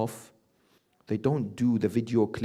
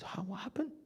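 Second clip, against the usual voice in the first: an unvoiced, breathless whisper of disbelief; whispered question; 8 semitones higher, 13 dB quieter than usual. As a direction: whisper it, stunned by the news. Stunned son asks in shock.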